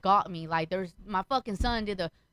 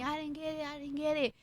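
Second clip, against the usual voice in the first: put-on soft, higher-pitched child's denial; lying son; 4 semitones higher, 8 dB quieter than usual. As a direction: imitate a kid quietly protesting innocence. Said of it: "he lied to me".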